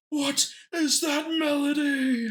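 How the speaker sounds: high, slow, booming Sigma voice; Sigma impression. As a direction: quote Sigma in a high drawn-out shout. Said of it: "he kept going".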